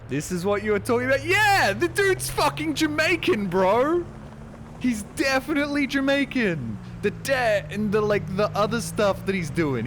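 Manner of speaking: loud, high-pitched excited shouting; heated, high and loud; worked-up outburst. Real, not acted. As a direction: burst out arguing your point, voice shooting way up. Streamer heatedly arguing character is Jamaican.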